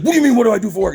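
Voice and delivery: high-pitched heated retort; imitating an affronted customer; indignant challenge. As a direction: throw the question back, worked up.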